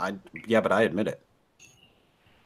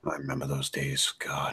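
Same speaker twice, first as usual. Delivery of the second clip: nostalgic whisper; 7 semitones lower, 13 dB quieter than usual. Pretend. Mock nostalgic reminiscing.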